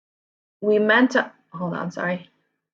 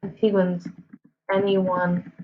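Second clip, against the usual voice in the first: typing aloud; 4 dB quieter than usual. Real, not acted. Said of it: "I'm typing like".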